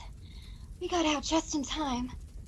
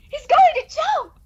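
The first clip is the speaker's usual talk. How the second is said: slow, much louder and higher scream; woman screams as Darrow jumps; horrified cry; 10 semitones higher, 10 dB louder, slower than usual. Acted. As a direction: cry out in horror. Woman sees man about to jump.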